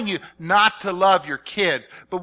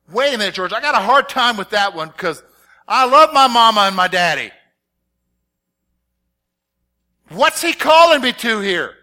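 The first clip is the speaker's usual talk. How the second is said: mock objection; 3 semitones higher, 8 dB louder than usual. Pastor voicing a congregant who loves his parents, loud objection. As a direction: protest like a shaken churchgoer.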